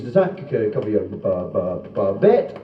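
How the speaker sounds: comic stutter on C and B; stammering punter. Acted. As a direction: struggle down low until the word pops out. Stuttering punter asks about bet.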